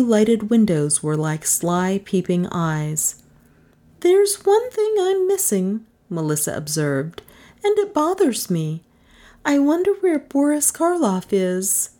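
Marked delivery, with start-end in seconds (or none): 4.0-5.8 high-pitched, wry woman's voice; wry Melissa. Acted. Visitor eyeing the gloomy house, high and wry. 7.6-11.9 high-pitched, wry woman's voice; wry Melissa. Acted. Visitor eyeing the gloomy house, high and wry.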